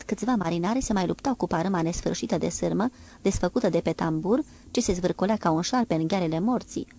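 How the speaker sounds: ordinary delivery, nothing marked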